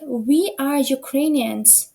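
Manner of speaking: "we are Ukrainians", every word drawn out slowly; slow model sentence. Shown as a demonstration. Teacher reads example to class, very slow and clear. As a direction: spell it out slowly so students catch the final s. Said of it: "«с» означає множина".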